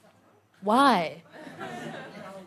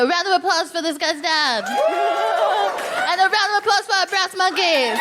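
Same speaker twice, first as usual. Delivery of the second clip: rally the crowd; calling out; 7 semitones higher than usual. Real, not acted. Calls for applause.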